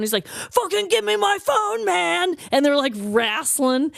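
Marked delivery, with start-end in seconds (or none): 0.3-2.4 high-pitched acted teen outburst; mock-angry teen, pitched way up; retold angry demand. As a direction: demand it back, voice jumping up high. Retold teen demand escalates into fight.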